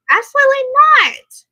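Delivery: loud, high-pitched outburst of refusal; real, forceful "no way" exclamation; emphatic denial. Real, not acted. Emphatically denies what viewer recalled.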